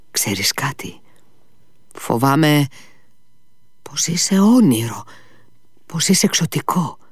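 hushed, frightened whisper; fearful whisper. Acted. Ivan whispering his fear to Smerdyakov. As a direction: whisper it like a secret dread. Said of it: "πρόφερε".

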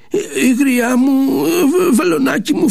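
put-on high, slow, tearful old man's cry; wailing old man. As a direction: plead in a broken old voice. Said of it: "φώναξε". (γέρος που φωνάζει κλαψιάρικα στο βελονάκι)

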